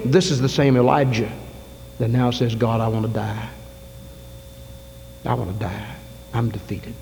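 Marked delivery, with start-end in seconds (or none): 2.5-6.9 a worn-out prophet's soft lament; despairing. Retold or imitated. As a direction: let the voice sink into hopelessness. Elijah despairing under tree.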